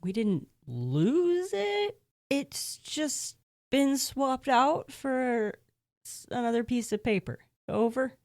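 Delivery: high, slow, drawn-out excuse; slow pleading excuse. Acted. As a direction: explain very slowly and carefully.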